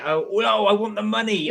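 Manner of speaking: greedy excitement. Pretend. Friend imagining the prize, laughing greedy cry. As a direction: grab at it playfully. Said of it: "so I say".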